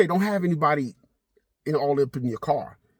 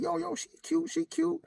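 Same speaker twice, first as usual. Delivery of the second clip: much higher put-on voice for the friend; imitating his friend; 7 dB quieter than usual. Friend Mike approving the girl, in a high mock voice. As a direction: squeak it out like the buddy. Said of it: "He said".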